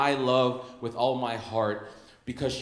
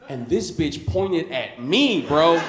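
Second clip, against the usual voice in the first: indignant, rising to a shout; outraged; 2 semitones higher, 7 dB louder, much slower than usual. Real, not acted. Outraged punchline.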